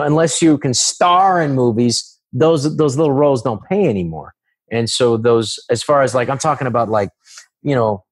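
ordinary delivery

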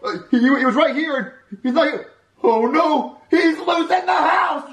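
high wail stretching into a shout; stammer, then cry out in drawn-out panic; panicked. Acted. Retake, child is loose.

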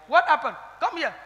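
normal talking voice

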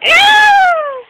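high, loud demonstration scream; imitated scream. Shown as a demonstration. Kid showing how they scream like them, mock scream. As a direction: show off a movie-victim shriek. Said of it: "we scream like them".

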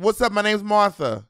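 hammed-up teen girl introducing herself; New York teen girl. Comic imagining a teen named Martha, mock New York girl voice. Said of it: "imagine a New York City fourteen-year-old girl".

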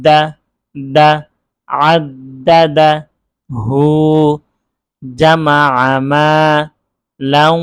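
JA, MA, then JAMA, built up with pauses; measured Arabic syllable drill; letter-by-letter reading. Shown as a demonstration. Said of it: "এইভাবে আমরা হরফান পড়লাম".

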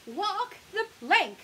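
high, loud, drawn-out order; commanding. A narrator's reading. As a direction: give a playful pirate captain's command. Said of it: "commands the pirate mommy".

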